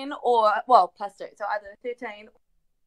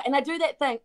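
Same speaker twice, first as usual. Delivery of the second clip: usual speaking voice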